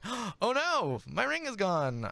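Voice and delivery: high, fast panicked NPC. Acted. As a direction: gasp and squeak it out in a panic. GM voices Bradley panicking.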